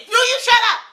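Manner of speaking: high loud snapping question; loud high mock yell; mock angry shout. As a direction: yell it at your mother. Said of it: "and then he said".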